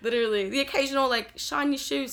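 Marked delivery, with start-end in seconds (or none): 1.4-2.1 lightly mimicked British accent, pitched up. Someone calling out to a student, mock British accent. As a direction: imitate a British heckler, higher. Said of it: "It's just, it's British".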